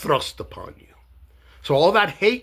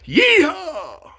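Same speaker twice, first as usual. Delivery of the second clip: a sudden high whoop, much louder; excited yeehaw; 13 semitones higher, 5 dB louder than usual. Retold or imitated. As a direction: whoop it like a cowboy at a rally. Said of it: "he turns to a camera and he goes".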